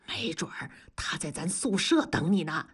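teasing conspiratorial whisper; a sly whispered hint. Acted. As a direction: lean in and whisper the gossip.

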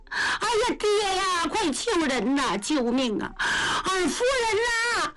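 put-on a girl sobbing out for someone to save her mistress; panicked crying plea. 丫头见老人急喊救人. (带哭腔的惊慌呼救)

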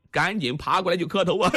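usual speaking voice

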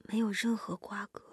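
soft, breath-thin muttered echo; trembling murmur. A narrator's reading. Stunned woman repeats the man's words to herself, murmuring shakily. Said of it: "声音颤抖， 喃喃地念叨着".

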